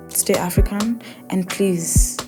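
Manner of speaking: ordinary talk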